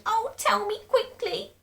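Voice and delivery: very high, loud begging; eager cry. A narrator's reading. Frightened wife begs very high to be told. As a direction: plead high and urgent.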